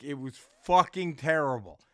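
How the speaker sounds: ordinary delivery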